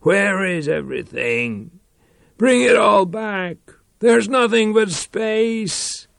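high, shouted plea; desperate cry. Acted. Reich in the void, crying out to bring it all back. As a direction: cry out in despair. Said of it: "he cried".

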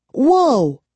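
high, loud startled whoa; startled cry. A narrator's reading. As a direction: cry out in alarm. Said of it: "Kara cried".

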